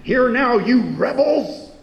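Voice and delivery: loud, high angry shout at the rebels; angry rebuke. Retold or imitated. Moses shouting at the grumbling people, angry. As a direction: hurl it at them in anger. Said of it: "you hear anger in his voice".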